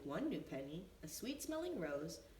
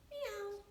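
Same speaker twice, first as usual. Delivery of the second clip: very high meow; cat meowing. A narrator's reading. Cat answers Benny with a high mew. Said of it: "said his cat".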